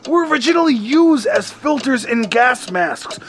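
ordinary talk

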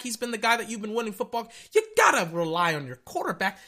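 ordinary delivery

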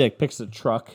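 ordinary talk

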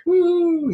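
high, held "woo"; celebratory whoop. Retold or imitated. Retelling her saying okay to marriage, high happy whoop. Retold relief at her yes.

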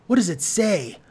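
anxious. A narrator's reading. Marcy Marcy asking Theodora about the note, anxious. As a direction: press anxiously for an answer. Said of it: "said Marcy Marcy anxiously".

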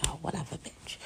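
a mock celebrity whispering a sneer; dismissive whisper. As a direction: whisper it like you can't be bothered.

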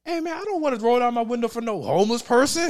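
lightly voiced annoyed friend; mock friend protest.

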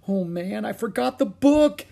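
high, rising dismay. Acted. Jack realising the book is left behind, dismayed. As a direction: build from groan to loud alarm.

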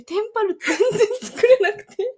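laughing while talking, high and loud; words spilling out through laughter. Real, not acted. Laughing while retelling frog fail.

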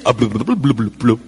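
low, burbling fish noises; deep bubbly fish babble; fish talk. Pretend. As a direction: gurgle like a little fish underwater. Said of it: "el otro le dice".

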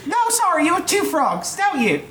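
put-on very high squeaky kid, laughing through; a pestering child, giggling. Altar boy voice.

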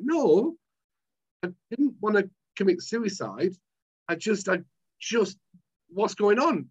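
desperate protest to a doctor. Retold or imitated. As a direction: insist you're not suicidal, just lost. Pleading with dismissive doctor.

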